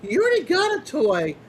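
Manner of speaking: scolding mom. Retold or imitated. Mom at the register refusing the husky a toy, mock stern. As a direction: tell off the husky like a weary mom. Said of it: "the mom's like".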